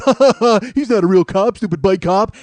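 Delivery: loud jeering laugh, then a sneer; mocking laugh. Everybody laughing at the bike cop, loud jeering. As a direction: jeer at him behind his back.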